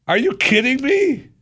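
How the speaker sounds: high, loud outraged disbelief. Retold or imitated. To TSA agent in line, a high loud outraged blurt. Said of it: "I was seriously like".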